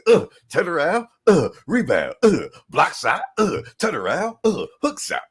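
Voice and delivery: loud, punchy list of moves; rapid play-by-play. Retold or imitated. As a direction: rattle off each move like a highlight reel. Listing Duncan's moves rhythmically.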